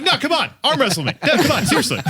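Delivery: high-pitched, insistent mock challenge; playful goading. Surgeon challenging colleague to arm wrestle, mock-insistent goading. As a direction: egg them on, half laughing, pitch up high.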